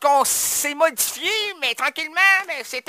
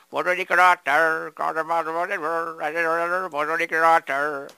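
lightly voiced lower, slow crooner take on the line; a hint of an old Quebec singer; 8 semitones lower, slower than usual.

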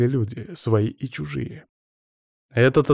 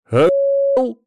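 angry swearing; 9 semitones higher, 4 dB louder than usual. A narrator's reading. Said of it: "Он выругался".

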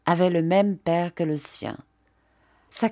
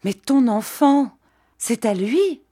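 higher, louder anguished question; crying out; 5 semitones higher, 4 dB louder than usual. A narrator's reading. Jeanne asking who fathered the child, crying out. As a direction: cry out the question in alarm. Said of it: "poussant un cri".

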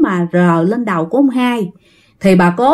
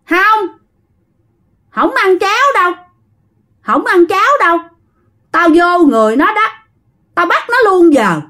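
high, insistent ghost speaking through a man; possessing spirit; 6 semitones higher than usual. Acted. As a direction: answer as the spirit that seized him. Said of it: "cái gương mặt vô hồn lắm".

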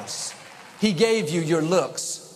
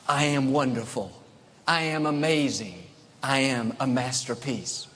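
lower, calm proud affirmation; calm confident declaration; 4 semitones lower than usual. Shown as a demonstration. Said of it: "He was saying, not in pride, but in praise".

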